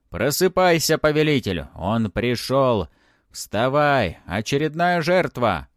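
loud, high, urgent cry to wake the master; shouting spirits. A narrator's reading. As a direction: spirits crying out for a victim. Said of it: "И тут крик возобновился".

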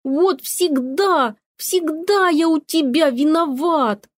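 high, drawn-out aggrieved wail; wailing cat. A narrator's reading. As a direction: wail slowly like a wronged cat. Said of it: "завопил Кузьма". (протяжный вопль обиженного кота)

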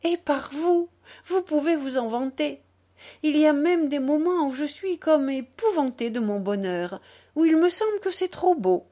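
high, overflowing happiness; rapturous, pitched well up; graceful effusion. A narrator's reading. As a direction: pour out your joy to a beloved. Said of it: "répondit-elle avec une gracieuse effusion".

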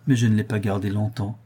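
plain reading voice

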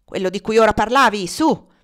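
loud, rising push to talk; impatient order. A narrator's reading. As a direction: push her forcefully to get on with it. Legré orders her to speak. (ordine impaziente, a voce alta)

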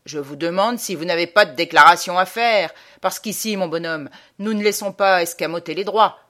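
loud, officious challenge from a policeman; city policeman. Acted. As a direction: lay down the law loudly, as an official. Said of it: "une espèce de sergent de ville". (voix forte de sergent de ville)

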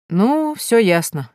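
slow, weary "all clear"; sighing resignation. Acted. Erika gives up on her friend, slow and sighing. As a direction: drag it out with a heavy sigh. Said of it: "тяжело вздыхая".

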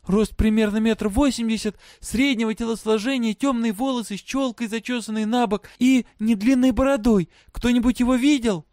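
high-pitched voice projected to a crowd; calling out. A narrator's reading. Girl describing her father to prisoners, calling out. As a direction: call out each detail, high and urgent. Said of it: "кричала она".

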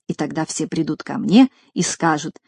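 usual speaking voice

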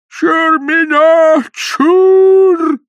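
high, slow, loud operatic wail. A narrator's reading. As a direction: declaim it like an opera bass in despair. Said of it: "с шаляпинскими интонациями".

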